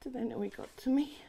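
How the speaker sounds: ordinary delivery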